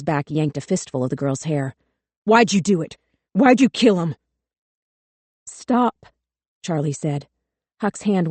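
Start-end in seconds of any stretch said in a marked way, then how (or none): 2.2-4.1 angry interrogating yell. A narrator's reading. Cop yells at the girl. 5.5-6.1 urgent higher stop. A narrator's reading. Charlie tries to halt the beating.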